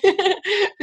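a high burst of laughter. Real, not acted. Telling a friend about joyful crying, high laugh.

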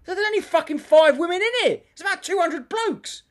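high, loud, incredulous retort; exasperated protest. Retold or imitated. Comic protesting to a heckling woman, high exasperated outburst. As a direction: act out indignant disbelief.

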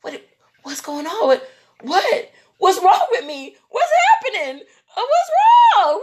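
high wail building to a shriek; acted panic. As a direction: escalate frantic questions into a loud cry. Acts out panicking in clinic.

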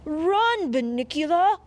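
high, loud warning shout; urgent shout. A narrator's reading. Dog warning the bunny of the cat's attack, shouting. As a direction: shout a warning at the top of your voice. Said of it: "I shouted".

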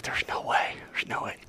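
hushed, unvoiced murmur; breathing it to himself in awe; whispered disbelief. Peter whispering to himself at the boat, hushed.

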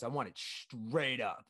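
"straight up" with straight drawn out slowly; exact imitation of customer. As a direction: imitate her slow, stretched "straight up". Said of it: "exactly like that".

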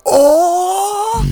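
high loud "Oh" as a song kicks in; excited gasp. Shown as a demonstration. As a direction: react like the song just hit. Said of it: "just be like".

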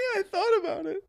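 high, breathless giggling; can barely talk for laughing. Real, not acted. Cracking up at pirate voices.

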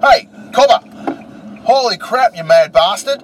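loud, high-pitched Aussie yell; strong Aussie accent. Acted. Co-host plays an old Australian horror film character, strong Aussie accent. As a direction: shout in hammed-up broad Australian. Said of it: "really strong Aussie accent, mate".

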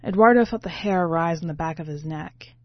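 ordinary delivery, nothing marked

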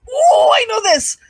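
high, loud blurt; gleeful yell from the front row; excited shout. Retold or imitated. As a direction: burst out with the answer. Blurting quiz answer.

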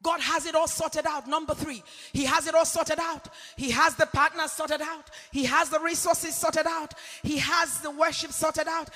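loud, fervent sermon delivery; preaching. Acted. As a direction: preach it with full conviction to the congregation. Preaching sermon at full force.

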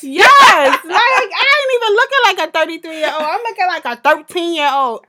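laughing while talking. Real, not acted. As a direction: talk through real laughter. Laughing at her own acne.

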